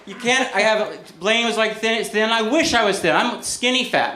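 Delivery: ordinary delivery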